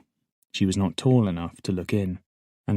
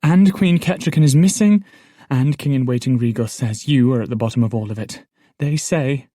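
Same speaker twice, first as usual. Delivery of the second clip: louder, higher, rushing urgency; breathless news blurted to a prisoner; hasty tumble of words; 5 semitones higher, 7 dB louder than usual. Acted. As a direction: pour it all out at once. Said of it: "tumbled from her in her haste".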